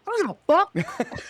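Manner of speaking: high, loud defiant cry; tough shout. Retold or imitated. Bragging to his co-host about facing Travis, a loud high defiant shout. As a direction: bark it out, refusing to back down.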